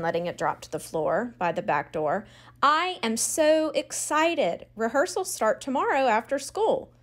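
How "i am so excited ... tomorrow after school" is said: excited. A narrator's reading. Girl bursting with happy news.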